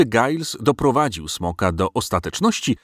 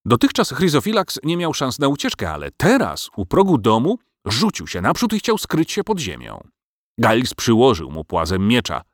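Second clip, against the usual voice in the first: usual speaking voice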